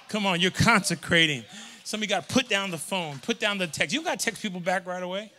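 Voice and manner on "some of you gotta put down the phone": usual speaking voice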